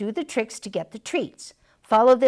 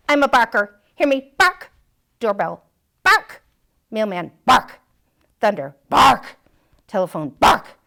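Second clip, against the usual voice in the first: proud barker; 7 dB louder than usual. Acted. Barking dog boasting to Mister Mutt, loud. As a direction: bark out each item with pride.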